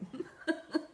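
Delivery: high, breathy giggle; self-conscious laugh. Real, not acted. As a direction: giggle at your own tongue slip. Laughs at own tongue slip.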